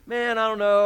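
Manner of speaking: loud exasperated shout; loud exasperated fisherman; frustrated fisherman. Acted. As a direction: throw up your hands in frustration.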